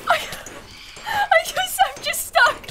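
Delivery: high, crying wail; mock wailing. Real, not acted. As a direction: wail it up high, half sobbing. Stuck, wailing.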